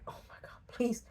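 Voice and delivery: begging in a hushed whisper; urgent whisper. Retold or imitated. Man secretly begging her to leave, whispering. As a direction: whisper the plea so no one hears.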